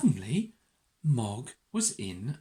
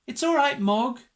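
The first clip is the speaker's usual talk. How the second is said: high, louder soothing; reassuring Nicky; 8 semitones higher, 6 dB louder than usual. A narrator's reading. Nicky calms Mog at the vet's, loud high reassurance. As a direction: raise the voice to comfort the cat. Second child soothing the cat.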